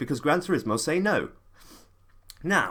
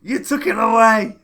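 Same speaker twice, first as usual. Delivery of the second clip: angry; 8 semitones higher, 8 dB louder than usual. Real, not acted. Angry repeated accusation.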